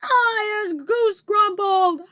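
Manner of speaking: very high, loud, slow howl; howling giant. A narrator's reading. Trapped giant howls in misery. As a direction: howl it out like a wounded monster. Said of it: "howled the man hugger".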